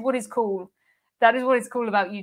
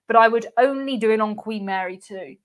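ordinary talk

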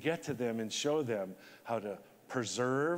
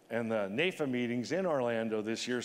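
ordinary delivery

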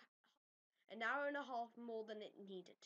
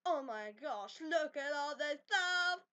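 loud, hammed-up awe; mock amazement; 5 dB louder than usual. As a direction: gush loudly in pretend amazement. Acting out awe at the mod.

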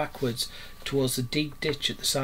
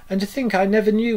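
high, raised, entranced musing; dreamy rapture; 7 semitones higher, 7 dB louder than usual. A narrator's reading. Toad carries on about his new craze, dreamy rapture. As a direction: marvel aloud as if lost in a dream. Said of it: "in a dreamy monotone".

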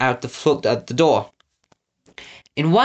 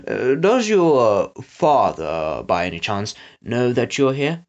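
halting, slow, mildly nervous question; 3 semitones lower, slower than usual. Acted. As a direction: feel your way nervously through the question. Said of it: "Snatcher said nervously".

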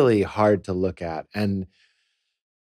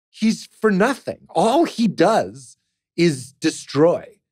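indignant inner voice; 8 semitones higher, slower than usual. Retold or imitated. Inner voice objecting, retold.

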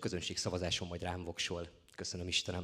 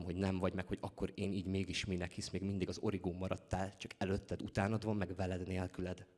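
ordinary talk, nothing marked

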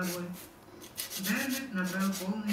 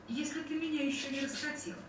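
coy, teasing question; flirtatious; 8 semitones higher than usual. A narrator's reading. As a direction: charm him with the line. Flirting, charming smile. (кокетливо)

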